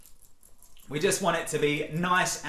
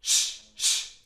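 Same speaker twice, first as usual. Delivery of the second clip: two voiceless punches of air; sharp little shh bursts; short shushes. Shown as a demonstration. Coach sets up the clean yell, two short shushes.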